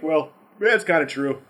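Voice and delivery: normal talking voice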